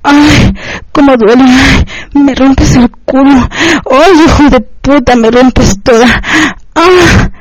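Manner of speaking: acted pained outcries; high pained cry. A narrator's reading. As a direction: cry out high, stretching the words. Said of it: "ella grita y un estruendo agudo".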